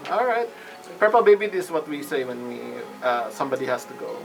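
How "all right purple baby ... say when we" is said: ordinary delivery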